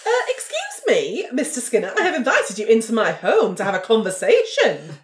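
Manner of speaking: prim, affronted and high on the excuse me; stiffly offended host reclaiming her home; indignant. Acted. Cam scolding Mister Skinner in her home, prim and indignant.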